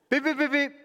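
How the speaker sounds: high string of mimicked beeps; imitated detector beeps. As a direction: beep like a security scanner going off.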